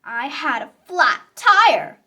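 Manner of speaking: ordinary delivery, nothing marked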